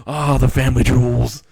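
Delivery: loud hammed-up groan of groin pain. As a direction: cry out like you just got hit below the belt.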